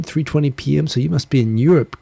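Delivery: ordinary talk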